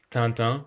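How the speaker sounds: Tintin with nasal vowels, like tan-tan; Tintin as French "tan-tan"; French-style pronunciation. Shown as a demonstration. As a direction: say Tintin the French way, n's barely sounded. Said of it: "no idea how we pronounce it".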